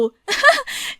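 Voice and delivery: high giggle that breaks out; sudden high-pitched laugh; burst of laughter. A narrator's reading. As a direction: laugh you can't hold in. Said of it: "ฉันกลั้นขำไม่อยู่".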